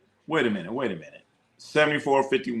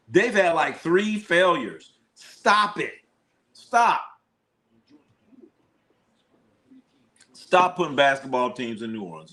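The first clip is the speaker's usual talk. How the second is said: exasperated; 4 semitones higher than usual. Real, not acted. Exasperated rant about team.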